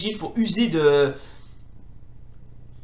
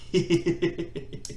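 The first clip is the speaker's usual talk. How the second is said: stifled run of giggles; 2 semitones lower, 7 dB quieter than usual. Real, not acted. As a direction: quietly snicker at your own trick. Amused at beating opponent.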